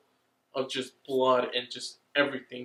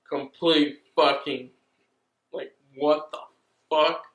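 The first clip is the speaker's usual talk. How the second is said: louder, worked-up what the fuck building to a yell; real hyped-up outburst; hyped disbelief; 2 semitones higher, 12 dB louder than usual. Real, not acted. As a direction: get carried away by the twist. Excited recap of twist.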